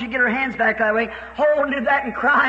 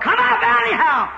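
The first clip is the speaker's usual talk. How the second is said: ringing command; 10 semitones higher than usual. Retold or imitated. To the bedridden girl, preacher's ringing high command. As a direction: call it out with full authority.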